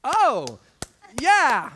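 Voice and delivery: loud, swooping dawning realization; snapping out of it. Retold or imitated. Stunned class coming round to applaud, sudden loud realization. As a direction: come to with a big exclamation. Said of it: "snap out of it".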